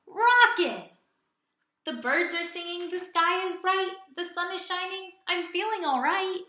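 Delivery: loud, high joyful cheer; thrilled, bouncy storytime chant; excited alligator. Acted. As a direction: sing out the refrain happily. Alligator delighted.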